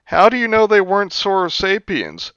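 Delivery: high, loud dumb-student voice; mocking the question. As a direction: squeak it out like a clueless student. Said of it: "some professor goes".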